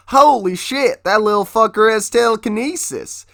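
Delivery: high, loud, amazed outburst; shocked exclamation. Retold or imitated. Cowboy Razorus seeing a boy use telekinesis, high amazed shout. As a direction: blurt it like you can't believe your eyes.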